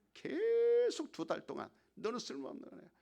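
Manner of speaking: high, loud jeering accusation; taunting devil. Acted. Preacher voicing the devil tormenting a disgraced actor, high jeering taunt. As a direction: hurl the insult like a tormentor. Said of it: "마귀가 공격하는 거야".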